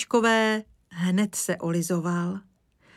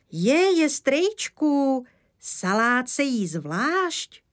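put-on child's cry; 7 semitones higher, slower than usual. Little Kája scolding the old woodsman at supper, high excited cry. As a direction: cry out like a shocked little boy. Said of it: "vykřikl Kája".

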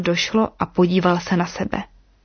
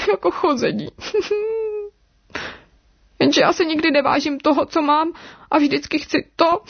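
high, acted weeping with sobbing breaks; regretful little snake crying hard; 8 semitones higher than usual. A narrator's reading. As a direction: lament tearfully, pitched well up. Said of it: "A dal se do usadavého pláče".

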